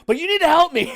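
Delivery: high, loud mock panic with a laugh; desperate high plea. Prankster begging the scam caller, high loud mock panic. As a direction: beg for help in a hammed-up panic. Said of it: "And I said".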